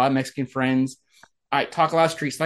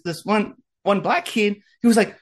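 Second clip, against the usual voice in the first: ordinary talk, nothing marked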